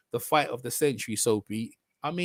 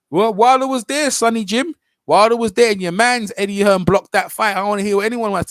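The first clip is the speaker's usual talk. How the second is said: raised, louder voice, arguing hard; heated shouting; 5 semitones higher, 8 dB louder than usual. Acted. Shouting back at his rival in the online spat. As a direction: yell the point down at him. Heated reply in a tweet argument.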